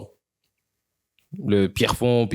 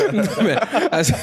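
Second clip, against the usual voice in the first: laughing; 10 semitones higher, much faster than usual. Real, not acted.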